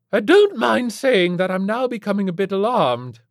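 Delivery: loud, high, haughty matron; grand, affronted declaration; declaring. A narrator's reading. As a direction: announce it like an imperious society lady. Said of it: "Missus Flintlock declared".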